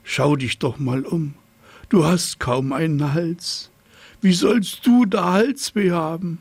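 high, lightly voiced giraffe scoffing; scornful giraffe. A narrator's reading. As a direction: talk down to the hippo. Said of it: "Die Giraffe hüstelte".

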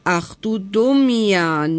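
slow, drawn-out meow-like scolding; meowing cat. A narrator's reading. Cat scolds the hedgehog with a slow meow. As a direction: stretch it like a cat's meow. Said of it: "miaute der Kater".

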